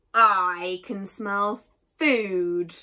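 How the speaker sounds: slow, loud bear yell. A narrator's reading. Unseen bear in the woods smells the picnic, yelling. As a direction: bellow it slowly, stretching each word. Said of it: "yelled a voice from nearby".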